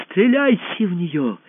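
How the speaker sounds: high, drawn-out cry of command; shouted order. A narrator's reading. Usurper to his bodyguards, shouted order. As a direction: cry it out high, stretching the words. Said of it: "крикнул он телохранителям".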